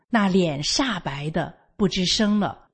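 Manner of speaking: plain reading voice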